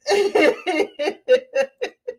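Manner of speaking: high, tickled laugh. Real, not acted. Streamer agrees with viewer Candace, high laughing. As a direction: let out a long high giggle. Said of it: "Y'all know I had to laugh".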